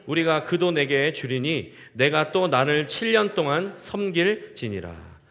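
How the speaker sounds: loud, solemn Bible reading slowing at the end; scripture reading. Acted. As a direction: read Laban's words aloud to the congregation. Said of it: "이십칠 절 함께 읽겠습니다". (큰 소리로 엄숙하게 성경 낭독)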